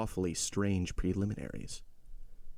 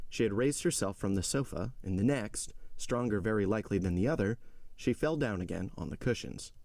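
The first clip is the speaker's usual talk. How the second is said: ordinary delivery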